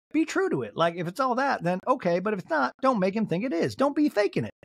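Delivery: high-pitched Southern lady scolding; Mary. Retold or imitated. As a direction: scold in a mock Southern accent. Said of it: "doing her as a Southern lady".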